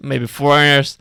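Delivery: put-on gruff voice. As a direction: drag it out in a put-on tough voice. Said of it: "Why am I doing this voice?".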